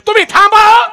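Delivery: high, loud shout at the husband; a fierce mother snapping back at her husband. Retold or imitated. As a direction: yell at him to stop. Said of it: "नवऱ्याला कशी म्हणते".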